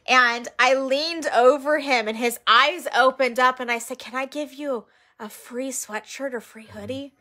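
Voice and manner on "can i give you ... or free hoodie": softly asking, leaning close; quiet, kind offer to a sleeping man; soft-spoken hoodie offer; gentle offer. Retold or imitated. Gently waking a man on the street.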